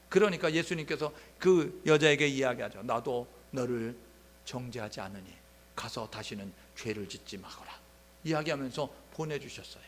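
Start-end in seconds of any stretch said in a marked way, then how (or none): 2.8-7.7 gentle forgiving Jesus. Retold or imitated. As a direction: lay it down tenderly, barely above a hush. (용서하듯 낮고 느리게 다정한 목소리)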